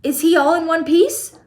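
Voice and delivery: high loud worried cry; fearful mother asking; anxious cry. A narrator's reading. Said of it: "cried Missus T V".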